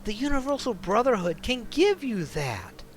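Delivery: urgent, worried man begging her to leave; pleading ex. Acted. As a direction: warn her like her life depends on it. Ex voices cult pitch.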